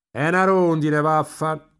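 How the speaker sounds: loud, high exclamation; calling out. A narrator's reading. Marcello spots a drowning swallow, loud high exclamation. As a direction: call it out across the boat. Boy calls out spotting swallow.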